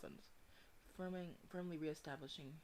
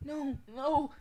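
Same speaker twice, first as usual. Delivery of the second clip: high, loud cry of distress; frantic and loud; panicked refusal; 10 semitones higher, 12 dB louder than usual. Acted. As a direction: cry out as control slips away. Character loses control in panic.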